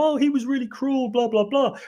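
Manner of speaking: mock girlfriend voice. A girlfriend judging him, mock gossip voice. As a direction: lightly mimic a gossiping girlfriend. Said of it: "could look at that and go".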